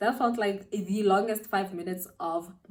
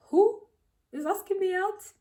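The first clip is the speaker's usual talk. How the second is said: high-pitched disbelief in retelling; incredulous; 11 semitones higher than usual. Retold or imitated. Girl replaying her reaction to being asked out, incredulous. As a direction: act out a disbelieving, high question.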